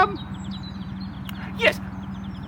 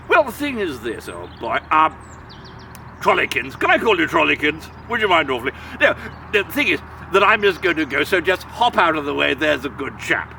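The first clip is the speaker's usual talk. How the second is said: put-on much lower, genteel old-chap voice; posh big billy goat; 11 semitones lower than usual. Big Billy Goat Gruff to the troll, low posh voice. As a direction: sound like a pompous old gentleman.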